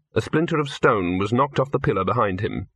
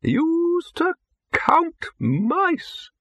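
put-on old man voice; much slower than usual. A narrator's reading. Old wizard Windlepoons voiced.

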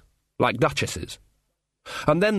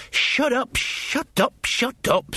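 nervous outburst; 13 semitones higher, much slower than usual. Acted. Jason panics, repeats it.

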